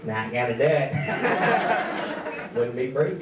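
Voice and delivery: low, slow, drawn-out presidential impression; hammed-up politician refusing, word by word; George Bush. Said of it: "He turns into George Bush".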